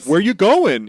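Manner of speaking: loud call from a passing bird. Retold or imitated. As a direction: shout it across the street. Calling out to Zoro.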